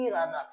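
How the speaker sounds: soft low model of ইরাদা, h silent; correct linked pronunciation. Shown as a demonstration. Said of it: "এই জন্য এটা উচ্চারণটা হয়ে যাচ্ছে".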